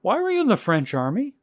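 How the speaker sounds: high, baffled retold question; puzzled questioning. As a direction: press him with high-pitched bafflement. Journalist questions him further.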